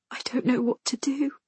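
put-on high, breathless girl's whisper; small, scared, hushed voice; frightened whisper. A narrator's reading. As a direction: whisper it helplessly. Terrified girl answers in whisper.

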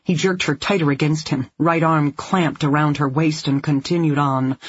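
ordinary delivery, nothing marked

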